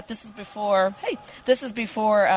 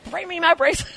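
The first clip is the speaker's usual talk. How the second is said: demanding celebrity; 8 semitones higher than usual. Retold or imitated.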